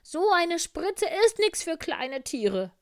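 very high, loud, show-off fox; boastful fox. Acted. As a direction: puff yourself up and brag loudly. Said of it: "spielte sich der Fuchs auf". (laute, hohe Angeberstimme)

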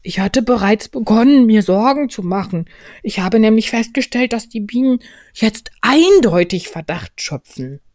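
high, loud bear, worried; Winnie der Pooh. Acted. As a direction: report urgently from up high. Voices Pooh in story. (hohe, besorgte Bärenstimme)

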